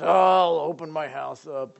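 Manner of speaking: acted reluctant groan into grumbling; mock grumbling. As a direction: grumble it like a put-upon host. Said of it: "do it without grumbling. Sometimes".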